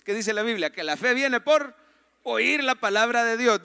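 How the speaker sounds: ordinary talk, nothing marked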